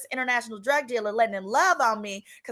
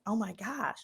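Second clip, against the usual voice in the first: a slow shocked whisper; 11 dB quieter, slower than usual. Retold or imitated. Hiding in the shower while he rants, whispering in shock. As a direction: breathe it out, aghast and unheard. Shocked hearing him cuss someone.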